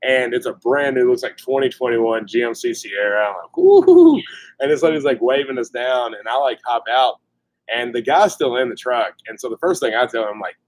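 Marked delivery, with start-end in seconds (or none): 3.5-4.2 high-pitched "woo-hoo-hoo" hoot; high, loud whoop. Retold or imitated. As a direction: whoop it out high and loud, imitating your reaction. Reacting to the truck's predicament.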